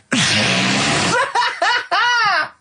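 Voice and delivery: short high giggle at his own joke. Real, not acted. [name] giggling at his own clue.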